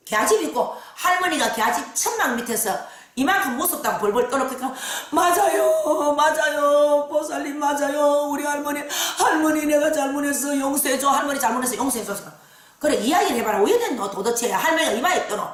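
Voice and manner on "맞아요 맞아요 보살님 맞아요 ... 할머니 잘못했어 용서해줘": crying out for forgiveness; tearful pleading. Retold or imitated. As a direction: beg grandmother to forgive. Client begging dead grandmother.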